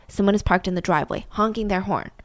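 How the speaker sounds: normal talking voice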